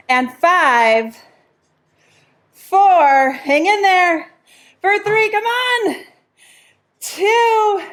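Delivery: high, drawn-out encouragement; effortful cheering. Real, not acted. Coach urging class through the final counts, strained high cheer. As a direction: push them through the last reps in a high voice.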